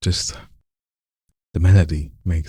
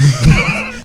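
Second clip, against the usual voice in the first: high burst of laughter; 9 semitones higher, 5 dB louder than usual. Real, not acted. Coach laughs at student.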